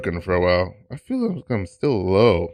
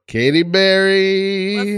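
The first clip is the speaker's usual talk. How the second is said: drawn-out, high hype announcer call; guest announcement; 9 semitones higher, 4 dB louder, much slower than usual. Real, not acted. Host introducing an improviser on deck, drawn-out announcement. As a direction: stretch the name out high and slow like a ring announcer.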